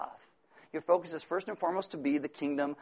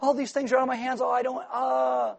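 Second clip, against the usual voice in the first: mock helpless fluster; 8 semitones higher than usual. Preacher voicing a overwhelmed believer, helpless. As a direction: sputter like you've lost control. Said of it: "We're like".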